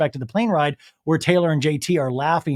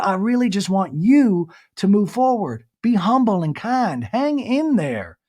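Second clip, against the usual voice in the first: high, earnest put-on mom voice; mock mom; 5 semitones higher than usual. Mom urges Taylor to move forward, high mock motherly. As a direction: mimic a mom's pep talk, pitched up. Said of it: "her mom's like".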